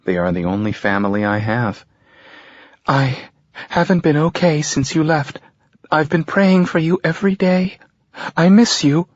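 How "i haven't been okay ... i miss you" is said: higher mother's voice, tearful; mother on the phone to her son, tearful and high. A narrator's reading. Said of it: "she's been crying".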